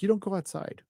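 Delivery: high, soft mock protest; retold incredulous retort. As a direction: retell it as a baffled comeback. Retells own incredulous retort.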